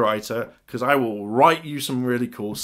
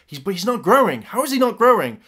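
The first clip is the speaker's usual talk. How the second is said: baffled disbelief; 7 semitones higher, 3 dB louder than usual. Acted. Rangers puzzled over a defeated monster, incredulous. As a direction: pitch climbing into a puzzled question.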